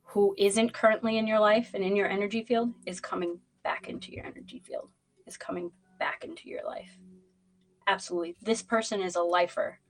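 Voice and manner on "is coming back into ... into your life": hushed. Real, not acted. Hushed prediction.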